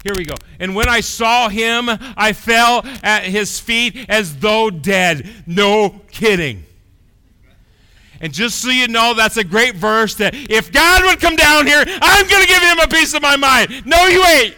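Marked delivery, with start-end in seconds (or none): 0.6-5.3 high, loud, slow proclamation; impassioned sermon reading; full-force preaching. Acted. Preaching the verse. 5.5-14.5 high, loud pulpit shouting; fiery preacher; preaching at full force. Real, not acted.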